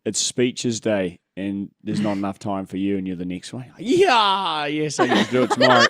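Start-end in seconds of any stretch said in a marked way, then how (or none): 3.8-5.8 mock relief. Acted relief at postponed school speech.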